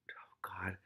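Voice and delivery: soft breathy whisper to herself; whispered aside. Real, not acted. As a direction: whisper it under your breath. Aside over the rat names.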